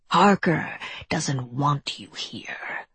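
slow, taunting whisper; creature whisper. A narrator's reading. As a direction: needle them slowly under your breath. Creature keeps whispering to girl.

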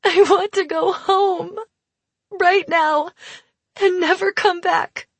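high, loud tearful plea; quavering wet voice. A narrator's reading. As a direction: wail through the tears. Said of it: "in a quavering, wet voice".

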